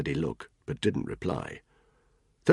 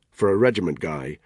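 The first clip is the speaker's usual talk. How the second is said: ordinary delivery, nothing marked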